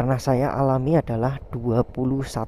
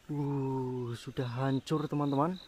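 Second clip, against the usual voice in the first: hushed; 15 dB quieter, slower than usual. Real, not acted. Whispering in an abandoned village.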